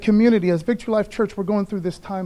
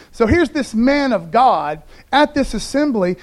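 normal talking voice

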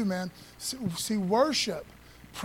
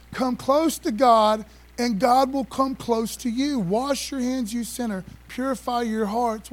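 louder, slow, ringing scripture proclamation; preaching scripture; 3 semitones higher, 4 dB louder than usual. Acted. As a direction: proclaim the verse to the whole church. Reads scripture with force.

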